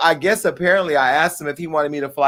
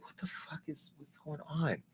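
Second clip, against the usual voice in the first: hushed, confused whisper; 21 dB quieter than usual. Retold or imitated. Waking to missed messages, hushed whisper. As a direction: whisper it, half asleep and baffled.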